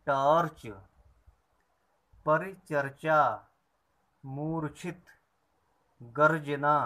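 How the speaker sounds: loud clear dictation of words; slow classroom recitation; word list drill. Shown as a demonstration. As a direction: read each word out for the class to repeat. Teacher reads word list aloud.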